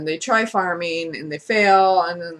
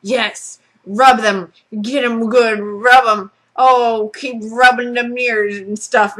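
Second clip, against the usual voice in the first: put-on loud leering old hermit; egging her on, loud and creepy; old man leering as girl washes ears; 2 semitones higher, 6 dB louder, slower than usual. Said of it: "And he's just going".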